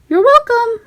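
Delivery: high friendly mouse voice; high Mickey voice. A narrator's reading. Mickey answering Donald, high and friendly.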